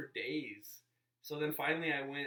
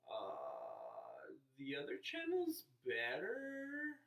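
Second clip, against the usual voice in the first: mock viewer complaint; much slower than usual. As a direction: imitate a whiny viewer, dragging the words out. Mocking imagined viewer complaint.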